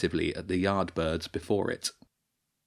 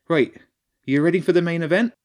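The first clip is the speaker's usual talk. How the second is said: quick, eager stage tech; eager lighting engineer; 9 semitones higher, 6 dB louder, faster than usual. A narrator's reading. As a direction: hurry him into position.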